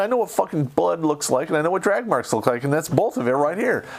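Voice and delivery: indignant veteran ranting at useless police; angry rant at cops. Retold or imitated. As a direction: tear into the cops, pitch climbing. Yelling at the police.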